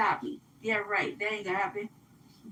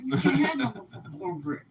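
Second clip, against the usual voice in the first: through gritted teeth; 3 semitones lower than usual. Retold or imitated. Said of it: "Like that, like, grind my teeth".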